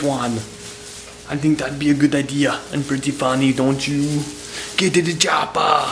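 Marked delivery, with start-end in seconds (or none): 4.8-5.8 put-on quick Austrian action-hero accent, loosely done; Arnold impression. Joking in the kitchen in a mock Arnold accent. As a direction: bark it like a film hero, in a light Austrian accent. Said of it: "talking like Arnold".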